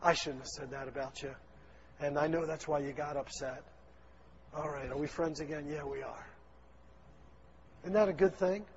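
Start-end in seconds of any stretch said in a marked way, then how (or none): 0.0-6.3 quiet, gentle making up; soft reconciliation. Pretend. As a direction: softly offer friendship back. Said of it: "something that indicates".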